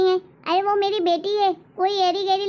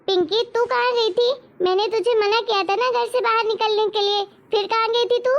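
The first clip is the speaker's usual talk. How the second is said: stern grandmother scolding; 4 semitones higher than usual. Acted. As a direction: bark at the girl like a strict granny. (दादी का ऊँची आवाज़ में डाँटना)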